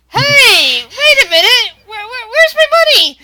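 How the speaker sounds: high, loud alarmed cry; frantic high-pitched bettor yelling. Acted. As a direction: shout in sudden panic over lost money. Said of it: "reaches down to his side and goes".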